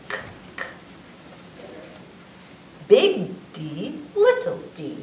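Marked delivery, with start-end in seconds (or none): 0.1-0.7 two soft unvoiced k sounds; hard K sound. Shown as a demonstration. Teaching the hard C sound, breathy k. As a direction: click out a breathy K, K. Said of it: "its long sound is K".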